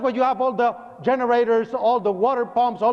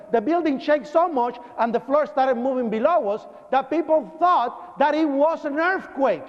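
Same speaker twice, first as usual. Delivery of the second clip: normal talking voice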